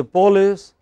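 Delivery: police as POL-iss; mistaken pronunciation. Shown as a demonstration. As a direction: stress the first syllable of police. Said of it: "you are misunderstood for saying".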